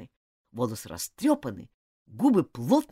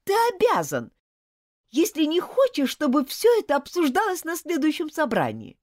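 insistent shout; 14 semitones higher, 7 dB louder than usual. Acted. Girl insisting on being heard.

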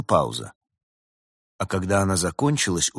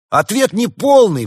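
high, loud objection; exclaiming; 12 dB louder than usual. A narrator's reading. Summer spirit rejects the businessman's answer, exclaiming. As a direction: cry it out like a quiz judge. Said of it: "воскликнул дух лета".

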